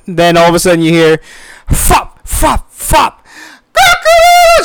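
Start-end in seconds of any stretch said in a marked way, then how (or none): 1.7-3.2 high imitated flapping sounds; flapping noise. Imitating wings flapping again. 3.7-4.6 rooster crow. Retold or imitated. Imitating rooster crowing again.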